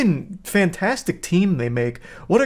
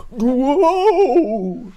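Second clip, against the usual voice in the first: mock whoa; 9 semitones higher, 3 dB louder than usual. Man rising from chair.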